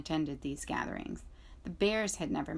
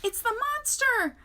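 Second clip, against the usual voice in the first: much higher, louder screech; screeching owl; 6 dB louder than usual. A narrator's reading. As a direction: screech it in alarm.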